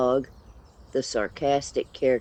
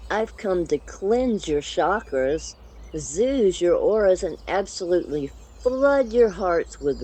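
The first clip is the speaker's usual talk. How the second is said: high, loud gushing diva; beaming unicorn; 8 semitones higher, 4 dB louder than usual. Acted. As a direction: gush over them, sugary sweet. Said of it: "Glitterhoof beamed".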